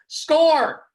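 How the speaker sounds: high, loud coach yelling; a PE coach hollering at his players from the bench. Retold or imitated. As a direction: bellow empty orders from the sideline. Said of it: "He'd yell stuff anybody could yell".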